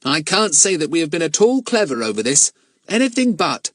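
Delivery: plain reading voice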